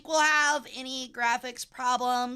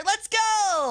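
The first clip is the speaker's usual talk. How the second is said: excited; 7 semitones higher than usual. Real, not acted. Lunch break, game starting.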